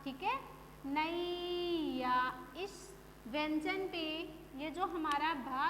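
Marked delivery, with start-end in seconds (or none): 0.9-2.1 नैया stretched slowly as na-eee-ya; slow नैया leaning on nai; stressed long ai. Shown as a demonstration. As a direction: hold the first syllable of नैया very long. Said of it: "इस पे आपको जोर देना है".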